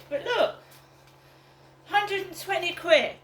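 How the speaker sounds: excited. Real, not acted. Showing off her money.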